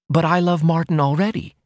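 put-on high plaintive girlish protest. A narrator's reading. Daughter protesting to her mother, plaintive and high. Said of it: "was the plaintive protest".

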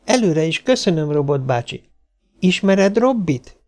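put-on high, polite child's voice; small girl asking a robot politely; little girl.

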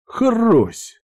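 very high, punchy spoken crack; imitated crunch. A narrator's reading. Narrator sounding the finishing blow to Rik, high crunch. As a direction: make the final hit crack.

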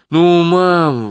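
loud, high, excited plea; eager exclamation. Acted. Daughter Sonya urging her mother on, excited plea. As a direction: beg mum with eager excitement. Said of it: "воскликнула Соня в азарте".

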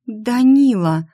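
startled, slow cry; gasped surprise. A narrator's reading. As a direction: exclaim the name in astonishment. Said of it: "ахнула я".

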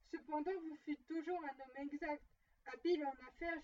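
ordinary delivery, nothing marked

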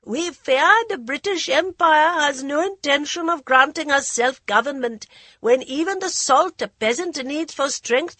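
high, loud speech to a crowd; high goading voice. Acted. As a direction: rouse the crowd, high-pitched. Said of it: "The high voice continued to goad them".

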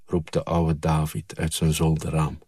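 ordinary delivery

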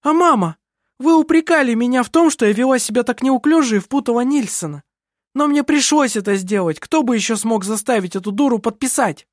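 high, agitated self-justification; indignant high-pitched tirade; heated reproach. A narrator's reading. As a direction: argue back hotly at your mother. Kerstin rails at mother and sister.

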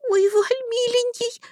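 put-on high, tearful girl's cry; sniffling plea. A narrator's reading. Girl clings to Darvald, high tearful plea. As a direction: sob it out high and pleading. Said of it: "хлюпнула я носом".

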